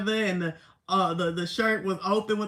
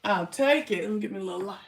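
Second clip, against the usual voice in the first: normal talking voice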